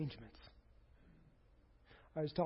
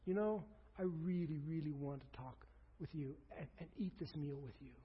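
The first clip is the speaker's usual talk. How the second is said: tender; 3 semitones lower, 8 dB quieter than usual. Acted. Jesus voiced tenderly to disciples.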